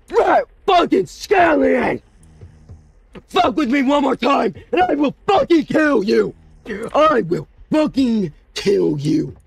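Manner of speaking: high screaming rage, slow; furious scream. Real, not acted. Gamer screaming at the baby that ran off, furious. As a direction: scream the threat.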